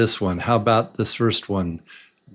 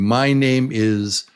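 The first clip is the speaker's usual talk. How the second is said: ordinary talk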